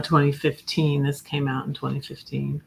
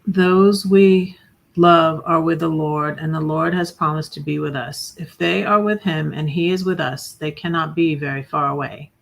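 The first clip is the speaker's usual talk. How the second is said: poem reading; 6 dB louder than usual. Acted. She reads a memorial quote, solemn recital. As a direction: read it out like a solemn epigraph.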